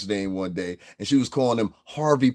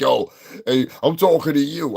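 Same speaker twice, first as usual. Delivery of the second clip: higher, louder mocking troll voice; imitating a troll; 6 semitones higher, 5 dB louder than usual. Streamer imitating an anonymous troll, mock call-out. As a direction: mimic the faceless troll calling out. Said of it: "then comes here and be like".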